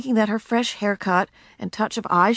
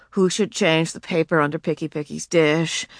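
ordinary delivery

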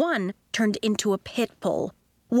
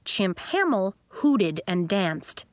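plain reading voice

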